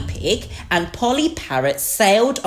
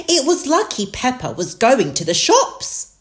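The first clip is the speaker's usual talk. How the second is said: high, lilting mother sheep; Mummy Sheep; 9 semitones higher than usual. A narrator's reading. Mummy Sheep is grateful for the shopping, high-voiced. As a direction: chat in a raised storybook voice. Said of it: "said Mummy Sheep".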